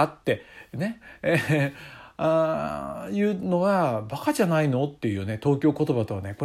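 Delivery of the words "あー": ordinary talk, nothing marked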